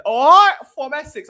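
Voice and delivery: mock Essex greeting, high and loud at the start; imitating an Essex accent, hammed-up; a loud, jokey Essex accent. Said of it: "The accent doesn't do it for me".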